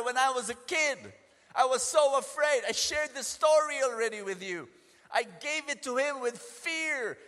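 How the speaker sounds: loud, high sermon delivery; fiery preaching. Acted. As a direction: drive the scripture home with fire. Preaching at full force.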